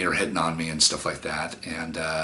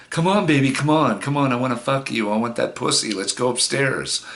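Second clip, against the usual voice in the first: hammed-up, higher pushy come-on; pushy guy; 6 semitones higher than usual. Imagined guy pressuring a girl upstairs, mock pushy. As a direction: play a sleazy guy pressuring her. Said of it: "What if it was me doing that".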